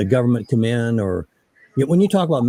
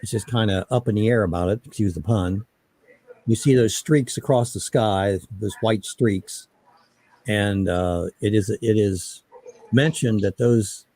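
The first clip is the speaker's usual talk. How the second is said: normal talking voice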